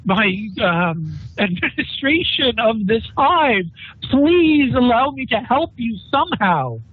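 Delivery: high, slow, begging voice; pleading governor. Acted. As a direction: stumble through it, pleading with the Inquisitor. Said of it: "he kind of stumbles and saying".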